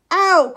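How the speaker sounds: loud, high "ow"; sudden yelp of pain; pained cry. A narrator's reading. Drake walking into something hard, crying out. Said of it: "cried Nell".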